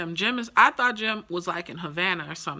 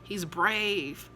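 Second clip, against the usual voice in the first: normal talking voice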